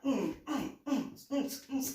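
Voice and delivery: high, rhythmic humming pulses; imitated rave beat. Imitating rave music she heard, rhythmic humming. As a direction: thump out a club beat with the voice. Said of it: "soon as I heard the music".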